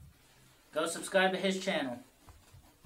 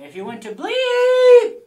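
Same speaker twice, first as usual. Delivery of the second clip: high drawn-out bleep on the name; mock censor bleep; 16 dB louder, slower than usual. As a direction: sing out a bleep tone.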